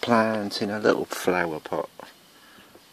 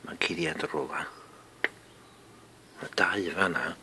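hushed whisper; 3 semitones lower, 8 dB quieter than usual. Real, not acted. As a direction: whisper it under your breath. Whispering while searching.

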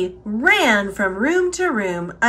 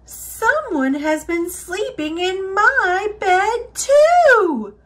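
Mama Bear; 7 semitones higher than usual. A narrator's reading. Mama Bear finding her bed slept in, crying out. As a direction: cry out indignantly, peaking on the last word. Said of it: "Mama Bear cried".

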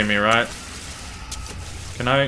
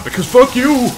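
loud, high angry yell; angry shout; 8 semitones higher, 6 dB louder than usual. Real, not acted. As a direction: shout it in triumph. Gamer yelling at enemy.

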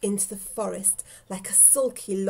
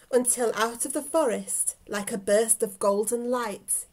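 ordinary delivery, nothing marked

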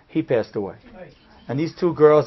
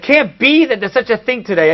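retold yell, louder and higher; raised-voice, incredulous objection; 6 semitones higher, 5 dB louder than usual. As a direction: loudly protest in disbelief. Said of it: "I yelled at him because I said".

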